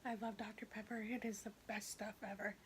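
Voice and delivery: soft unvoiced ASMR whisper. Whispered ASMR aside while eating.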